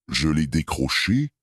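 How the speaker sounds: plain reading voice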